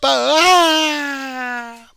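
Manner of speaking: long, high, loud scream; falling scream. Acted. Follower going over the cliff, long high scream.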